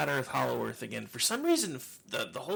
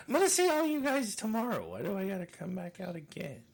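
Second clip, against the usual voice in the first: mock complaint; 9 semitones higher, 6 dB louder than usual. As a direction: complain theatrically in a raised, put-on voice. Mimicking own future holiday complaint.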